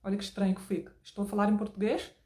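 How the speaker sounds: imitated European Portuguese accent. Shown as a demonstration. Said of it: "mudar para o português de Portugal".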